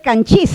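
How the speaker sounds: canchis stressed on the final CHIS, rising; stress on last syllable. Shown as a demonstration. Teacher showing what the rule would predict, end-stressed. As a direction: shift the accent onto the end, canCHIS. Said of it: "Pero no es canchis".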